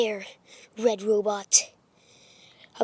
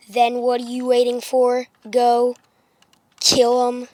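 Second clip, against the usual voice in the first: ordinary delivery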